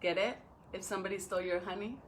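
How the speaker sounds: usual speaking voice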